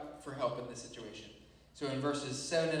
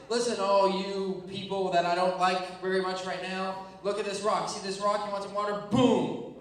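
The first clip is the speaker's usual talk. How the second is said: loud, raised-pitch mock outburst; frustrated; 4 semitones higher, 7 dB louder than usual. Moses snapping at the complaining people by the rock, loud frustration. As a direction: lose patience, building to a booming finish. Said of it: "He was so frustrated".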